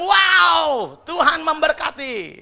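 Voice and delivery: loud, high shout of wonder; booming, drawn-out acclamation; exclaimed response. Shown as a demonstration. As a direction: cry it out slowly in amazed praise.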